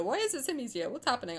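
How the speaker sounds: normal talking voice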